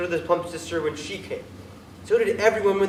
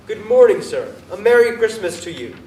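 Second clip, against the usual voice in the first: bright, loud holiday greeting; cheery passers-by; 4 semitones higher, 7 dB louder than usual. Acted. Fellows greeting Scrooge in street, loud and cheery. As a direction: call out a hearty good morning. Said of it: "good human fellows said".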